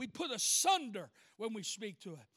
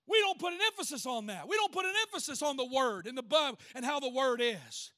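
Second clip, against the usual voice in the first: ordinary delivery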